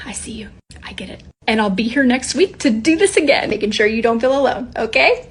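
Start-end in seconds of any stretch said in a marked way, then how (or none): 0.1-1.2 very soft, intimate reassurance; tender whispered comfort. Real, not acted. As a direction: whisper it to a struggling parent. Comforting viewers.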